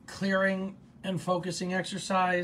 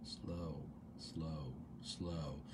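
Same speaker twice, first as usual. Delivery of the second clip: very soft, low mantra; hushed, falling repetition; near-whispered intention; 10 semitones lower, 15 dB quieter, much slower than usual. Shown as a demonstration. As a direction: murmur each word to yourself, evenly spaced. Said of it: "So you could whisper it".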